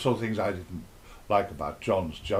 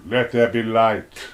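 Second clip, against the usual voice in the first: slow, louder booming with echo; God with echo; 6 dB louder, slower than usual. Shown as a demonstration. Telling God's diary, says it slow with echo. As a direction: boom it out slowly as God with an echo. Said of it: "and then you put the echo".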